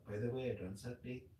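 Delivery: quick, soft, cute retelling; cute imitation. Retelling how the date said it, cute and soft. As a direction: toss it off softly and cutely, imitating her. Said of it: "She said it cute".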